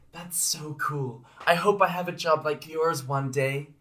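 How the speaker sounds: a hint of a high little girl. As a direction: voice an admiring daughter. Voicing the little daughter.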